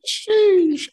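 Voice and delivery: playful high Sheesh; squealed sheesh. Real, not acted. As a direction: echo the hype in a squeak. Echoing partner's hype.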